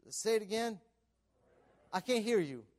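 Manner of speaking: ordinary talk, nothing marked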